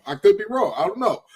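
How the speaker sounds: ordinary talk, nothing marked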